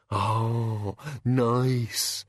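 lightly voiced gratified monster; mild creature approval; pleased bogeyman. Bogeyman pleased by Susan's tip.

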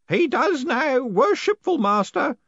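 put-on high, loud old man's response; gleeful, drawn-out ceremonial shout; happy shout. A narrator's reading. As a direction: shout the ritual answer happily, pitched high. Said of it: "shouted Groat happily".

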